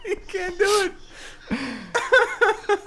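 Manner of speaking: high-pitched words squeezed through laughter; real helpless laughter. Real, not acted. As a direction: crack up while getting the words out. Laughing at the derailed segment.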